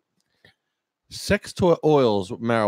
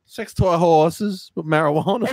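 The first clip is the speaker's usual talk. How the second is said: higher, hammed-up ad read of "horses"; mock ad read; 8 semitones higher than usual. Spoofing the caller's list, as a cheesy ad. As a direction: sell it like a cheesy commercial.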